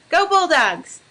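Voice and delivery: loud rah-rah cheer; school cheer. Real, not acted. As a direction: chant it like a pep rally.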